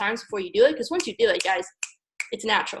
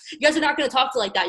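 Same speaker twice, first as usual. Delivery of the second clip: ordinary talk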